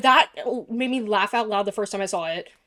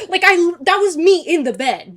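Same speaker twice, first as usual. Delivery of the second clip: giddy; 7 semitones higher, 9 dB louder than usual. Retold or imitated. Reliving giddy joy.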